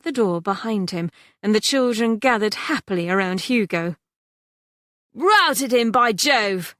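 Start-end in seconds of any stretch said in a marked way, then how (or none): 5.1-6.7 put-on louder, triumphant boy's cry; boy cheering the creditor's rout; exclaiming. A narrator's reading. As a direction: crow it in triumph. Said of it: "exclaimed Percy".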